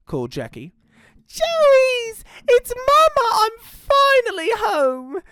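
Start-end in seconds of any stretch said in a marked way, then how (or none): 1.3-5.2 very high, loud singsong fake mama; sweet high voice. A narrator's reading. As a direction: trill it high and sugary, stretching the last words. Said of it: "came a sweet and high voice".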